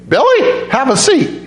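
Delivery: put-on high, sweet little old lady; a tiny grandma beckoning her grandson. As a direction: call him over like a tiny grandma.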